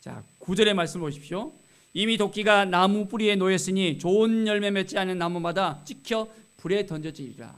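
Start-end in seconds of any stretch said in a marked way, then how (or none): 1.9-7.5 loud, declaimed verse of warning; forceful Bible reading, easing at the end; scripture reading. Acted. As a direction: proclaim the verse firmly from the pulpit. Reads scripture aloud to congregation.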